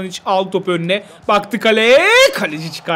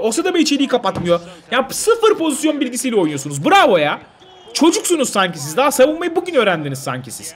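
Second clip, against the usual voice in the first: angry rant; 5 semitones higher than usual. Real, not acted. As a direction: tear into the players. Ranting at his defence. (takıma öfkeyle çıkışarak)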